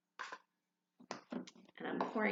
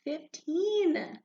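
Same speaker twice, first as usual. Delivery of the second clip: excited; 8 semitones higher, 7 dB louder than usual. Acted. End of counting.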